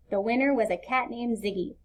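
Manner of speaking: plain reading voice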